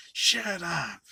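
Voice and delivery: loud, high retold yell; mock shout. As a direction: shout it as the imitated villain. Villain yelling at religious guy.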